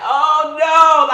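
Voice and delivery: high, loud cry of dismay; loud high shocked cry; horrified oh no. Retold or imitated. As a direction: wail it like the worst news. Retold reaction to bad news.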